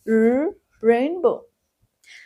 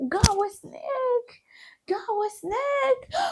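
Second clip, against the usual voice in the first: mock scared; 11 semitones higher, 3 dB quieter than usual. Pretend scared of snake.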